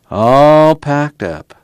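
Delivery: drawn out slowly, musing; slow wistful repeat; slow echo. A narrator's reading. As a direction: let each word hang. Muley echoing slowly.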